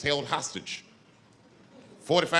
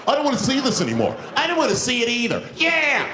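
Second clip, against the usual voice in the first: high, loud indignant chorus; suddenly brave outcry; 8 semitones higher, 5 dB louder than usual. Acted. Bus passengers joining the complaint, indignant shouts. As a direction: pile on loudly with fresh courage. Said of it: "all these dummies on the bus, now they're brave".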